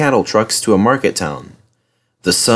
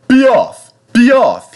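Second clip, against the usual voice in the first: high, loud sharp command; hissed order; 13 semitones higher, 6 dB louder, slower than usual. A narrator's reading. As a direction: drive it away with two explosive shouts. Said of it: "he hissed".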